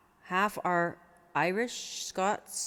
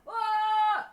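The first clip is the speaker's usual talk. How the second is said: a sudden high belted "Ah!" well above the speaking voice; high sung cry; 3 dB louder than usual. Shown as a demonstration. Singer showing how she sings off-mic to spare the condenser, high belted cry. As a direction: sing one high note off to the side, away from the mic. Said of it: "sing over here".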